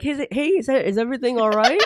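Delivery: high, sweet server voice; waitress voice. Retold or imitated. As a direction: mimic a chirpy waitress. Imitating the waitress.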